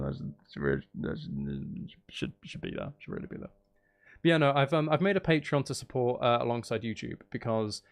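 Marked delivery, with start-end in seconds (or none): 0.0-3.5 trails off into a soft whisper; fading to whisper. Acted. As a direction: let it fade until it's just a whisper. Trailing off playfully to herself.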